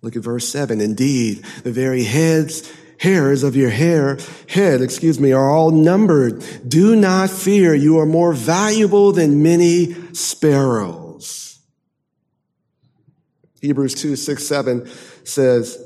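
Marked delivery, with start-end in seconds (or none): 0.8-11.6 climbs louder and higher to a ringing "Do not fear"; building scripture reading. Acted. Preacher reassuring the congregation, rising to a loud, high climax.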